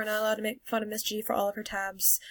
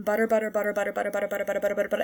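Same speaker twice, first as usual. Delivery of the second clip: tongue drill, butter over and over; rapid practice chant; fast drill repetition; much faster than usual. Shown as a demonstration. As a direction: rattle off butter, butter quickly. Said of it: "over and over again".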